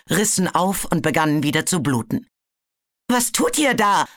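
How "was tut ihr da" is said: high, yelping plea; a wounded dragon yelping in dismay; whining. A narrator's reading. Webbs whines at the attacking dragons. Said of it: "jaulte Webbs".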